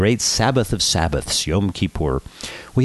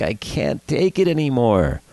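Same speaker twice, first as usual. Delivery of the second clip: higher, slow, desperate plea; beg, voice pitched up and drawn out; pleading in tears; 6 semitones higher than usual. A narrator's reading. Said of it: "poor Maury Schwartz is in tears".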